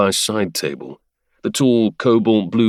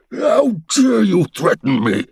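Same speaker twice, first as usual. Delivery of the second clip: furious, higher, louder shout; 10 semitones higher, 3 dB louder than usual. Acted. The Duke rages at his son with a furious, high shout. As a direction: bellow it in outrage. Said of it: "the Duke shouted".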